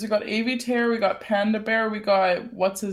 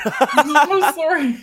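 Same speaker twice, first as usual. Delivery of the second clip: apologetic laugh, raised pitch; laughing apology; 7 semitones higher, 8 dB louder, slower than usual. Real, not acted. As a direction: laugh through a quick sorry. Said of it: "Are we just, like, not gonna".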